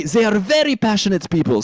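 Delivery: high, emphatic French accent; passionate French accent. Shown as a demonstration. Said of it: "if you have a French accent".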